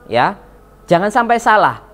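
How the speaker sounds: normal talking voice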